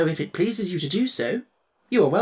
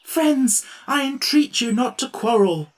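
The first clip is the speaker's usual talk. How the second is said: higher, louder anxious pleading; anxious plea; 10 semitones higher, 5 dB louder, slower than usual. A narrator's reading. Tin Woodman pleading with the quarrelling friends, anxious. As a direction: beg them to stop. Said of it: "pleaded the Tin Woodman anxiously".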